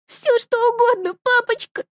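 put-on little girl calling daddy; frightened child. (высоким детским голосом)

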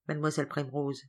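usual speaking voice

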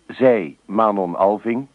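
ordinary delivery

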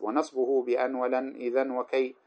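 slow chanted poem, rising in level; verse recitation. Acted. Teacher chanting the poem on the particles to students.

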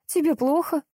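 high-pitched concerned question; anxious, voice lifted; worried. A narrator's reading. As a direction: check anxiously if she's unwell. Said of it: "обеспокоенная Маня".

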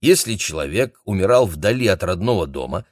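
usual speaking voice

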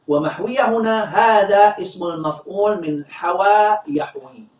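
ordinary talk, nothing marked

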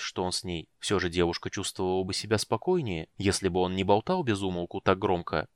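ordinary delivery

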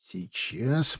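a soft, lisped "Сейчас". A narrator's reading. Soldier biting a cartridge, lisping "Сейчас" softly. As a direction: say "Сейчас" quietly with slurred sibilants, as round something in the teeth. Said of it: "прошепелявил тот".

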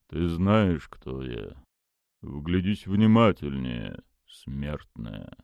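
low, slow, lordly deity; dark god voice. Acted. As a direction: speak down to a mortal. Dark god speaks to Mila.

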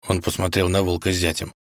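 plain reading voice

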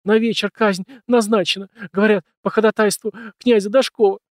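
high, loud sobbing. A narrator's reading. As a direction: sob out the terrible news. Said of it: "Она зарыдала".

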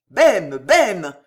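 loud, repeated call by name; insistent, carrying call. A narrator's reading. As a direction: shout up to summon him. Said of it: "appela encore Henri de Guise".

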